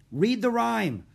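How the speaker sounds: put-on high eager girl's voice; bright young Annie; little girl voice. Annie's voice in story.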